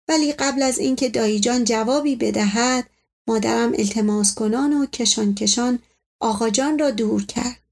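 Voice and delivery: ordinary delivery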